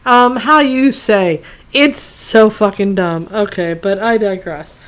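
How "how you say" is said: slow mock Italian accent; badly faked Italian accent, groping for a word; worst Italian accent. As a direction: imitate a bad Italian accent, drawn out. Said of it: "doing the worst Italian accent".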